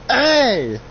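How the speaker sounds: high, loud, drawn-out yell; indignant shout. Acted. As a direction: object loudly with a high cry. Acted protest at being called old.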